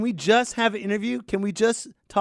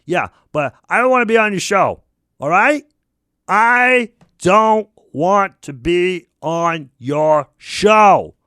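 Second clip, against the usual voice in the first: louder, slowing down word by word; angry refusal; 7 dB louder, slower than usual. Acted. Telling the host he won't be on the show, angry and slow. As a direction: spell it out angrily.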